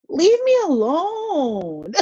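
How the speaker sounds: high, very slow whiny wail with a laugh; mock crying whine. Fed up with family demands.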